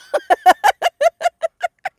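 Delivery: very high trailing laugh; amused squealing laugh at her own joke. Real, not acted.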